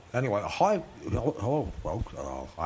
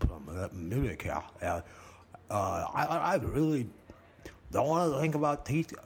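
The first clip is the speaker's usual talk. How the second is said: ordinary talk, nothing marked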